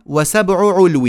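slow, loud recitation of a verse line; recited verse. Shown as a demonstration. Teacher leading students in reciting the rule, slow loud chant. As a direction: chant وسبع علو slowly and loud. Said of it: "قولوا معي".

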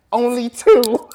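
short loud bark of an order; loud mock command. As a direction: snap it out loud like a fed-up ark keeper. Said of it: "I'd be like".

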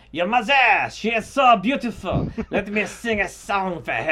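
loud, high hammed-up French accent; over-the-top French serenader. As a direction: belt it out as a mock Frenchman. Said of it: "I would just do a French accent".

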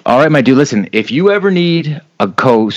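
ordinary delivery

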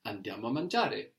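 "Andiamo a mangiare." said as a flat statement, falling on "mangiare"; statement tune. Shown as a demonstration. Teacher shows the statement version, falling tune on "Andiamo a mangiare.". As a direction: let "Andiamo a mangiare." drop at the end like a plain statement. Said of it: "Here we make it sound like a statement".

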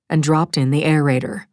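usual speaking voice

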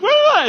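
loud, high imitated bawling; toddler tantrum wail. Preacher imitates a toddler having a tantrum, mock wailing. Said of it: "a toddler who's having a tantrum".

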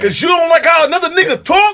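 high loud shout; mock shout. Quoting the show boss, high loud mock shout. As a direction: bellow it like the furious boss. Reenacting show scene, yelling.

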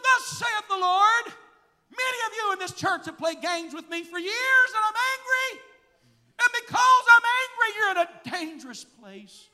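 high, loud proclamation; prophetic shout. Retold or imitated. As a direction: thunder it out as a prophecy. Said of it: "sort of did like this".

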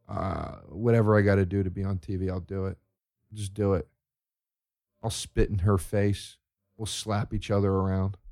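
soft, low, lazy reality-star voice. Retold or imitated. Reality show contestant shrugging off dignity, softly. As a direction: mumble it like a fame-hungry nobody. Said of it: "pig people that go".

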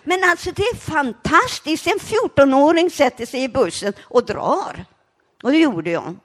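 high, loud proud exclaim; excited. Real, not acted. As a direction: crow about your own daring. Proud, excited about escaping.

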